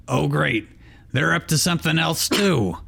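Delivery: Danny DeVito Pikachu. Acted. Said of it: "Danny DeVito".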